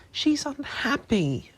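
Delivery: high and slow, fed-up; exasperated. A narrator's reading. Hermione pleading for Winky to the elves, exasperated. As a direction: sigh it out, frustrated.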